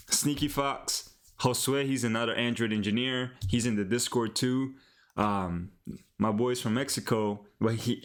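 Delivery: ordinary talk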